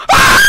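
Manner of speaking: real startled scream; frightened scream. Real, not acted. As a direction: scream at a sudden scare. Jump scare in horror game.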